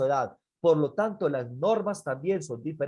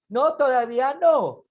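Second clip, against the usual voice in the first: high, loud mock tantrum refusal; defiant child; 9 semitones higher, 7 dB louder than usual. Boy refuses father again, high loud protest. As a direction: yell it like a stubborn little boy.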